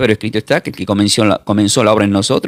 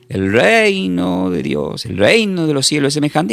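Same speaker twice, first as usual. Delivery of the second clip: higher, drawn-out proclamation; quoting the Lord; 6 semitones higher, slower than usual. Retold or imitated. Preacher quotes the Lord beginning parables, higher drawn-out proclamation. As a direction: stretch the words out like an announced refrain. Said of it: "el Señor empieza a decir".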